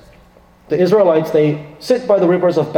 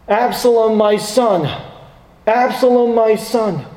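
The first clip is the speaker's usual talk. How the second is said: mourning cry; 6 semitones higher than usual. Acted. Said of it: "King David mourns".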